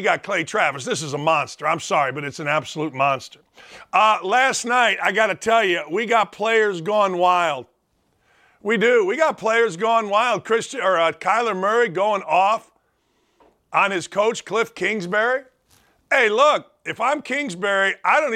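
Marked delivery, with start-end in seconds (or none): none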